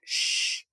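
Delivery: long unvoiced "shh"; shushing. A narrator's reading. Pete quiets his friends, a long shushing hiss. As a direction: hush them with a drawn-out hiss.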